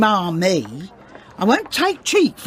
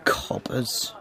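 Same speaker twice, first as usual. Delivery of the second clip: contemptuous mutter; 10 semitones lower, 10 dB quieter than usual. Acted. Woman mutters as police leave.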